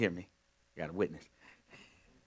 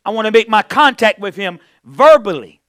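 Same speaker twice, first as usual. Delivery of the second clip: louder, higher preacher's call; preaching; 11 semitones higher, 19 dB louder than usual. Acted. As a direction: lift the congregation with it. Preaching to congregation.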